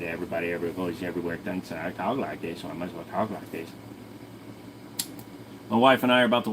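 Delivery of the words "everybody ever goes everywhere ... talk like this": lower, much softer, strange character voice; odd put-on voice. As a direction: talk like a weird little creature. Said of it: "Why do you talk like that?".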